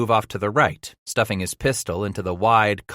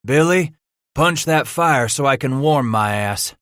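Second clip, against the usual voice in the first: tall leader's order; 6 semitones higher, 5 dB louder than usual. A narrator's reading.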